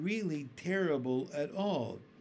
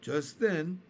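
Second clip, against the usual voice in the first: ordinary delivery, nothing marked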